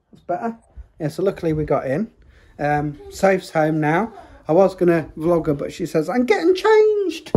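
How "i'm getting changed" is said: put-on high, louder teen voice, huffy; mock-huffy daughter, pitched high; mimicking daughter. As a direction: play the daughter brushing off the camera.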